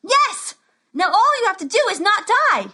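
put-on high, loud young wizard; excited high shout. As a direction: squeal with joy. Wizard cheering.

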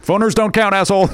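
high squeaky insult; high mocking heckle. Mock heckle on podcast.

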